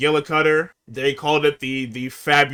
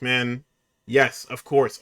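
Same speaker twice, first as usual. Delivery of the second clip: normal talking voice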